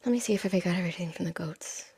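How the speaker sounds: unvoiced murmur under the breath; whispered aside. Real, not acted. Reader checks the goat cards, whispered aside.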